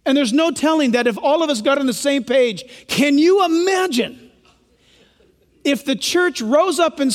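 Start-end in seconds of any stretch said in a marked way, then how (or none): none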